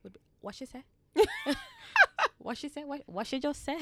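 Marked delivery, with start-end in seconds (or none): 0.4-0.8 high, softer puzzled gran voice; uncomprehending gran. Retold or imitated. Granddaughter imitating gran who can't follow her English accent, high puzzled voice. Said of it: "'Cause my, my gran would be". 2.4-3.8 high-pitched mimicry of a baffled gran; uncomprehending gran. Granddaughter imitating gran who can't follow her, high baffled voice. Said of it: "'Cause my, my gran would be".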